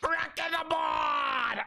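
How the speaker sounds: very slow, high shouted pirate cry. Shown as a demonstration. Shouting a pirate battle cry at the crew. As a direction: yell it across the deck. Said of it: "actual pirate talk".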